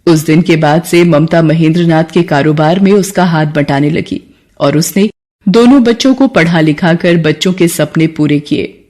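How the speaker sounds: usual speaking voice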